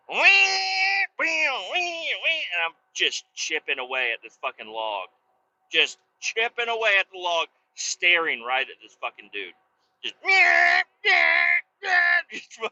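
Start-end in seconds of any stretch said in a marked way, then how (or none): 0.1-2.4 high revving chainsaw whine; imitated chainsaw. Man running a chainsaw by the fence, whining revs. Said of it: "and I go". 10.2-11.6 high, loud buzzing revs; imitated chainsaw. Man chipping at a log to annoy neighbor, buzzing saw.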